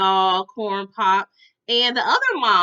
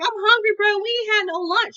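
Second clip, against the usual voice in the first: high, loud imitated whine of protest; hungry complaint; 10 semitones higher, 4 dB louder than usual. Boy who had no lunch complaining, high and insistent. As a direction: push the pitch up like a protesting kid.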